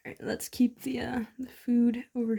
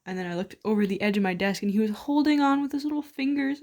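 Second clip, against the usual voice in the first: normal talking voice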